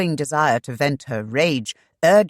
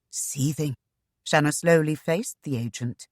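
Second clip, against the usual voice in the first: usual speaking voice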